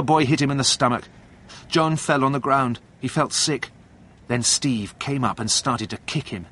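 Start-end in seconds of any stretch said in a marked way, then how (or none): none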